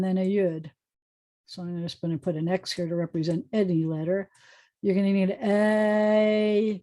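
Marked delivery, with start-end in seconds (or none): 5.4-6.8 "a" stretched slowly from eh into ee; diphthong. Shown as a demonstration. Teacher showing two vowels in one, drawn-out glide. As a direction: hold "a" long, gliding to ee. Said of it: "because it's a diphthong".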